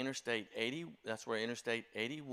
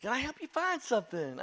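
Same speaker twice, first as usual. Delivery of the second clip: high, beaming shopkeeper greeting, much higher and louder; overly cheerful clerk; 13 semitones higher, 4 dB louder than usual. Retold or imitated. Liquor store clerk greeting the church staffer, beaming and high. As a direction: sing it out like a delighted salesman. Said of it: "suddenly he was so happy".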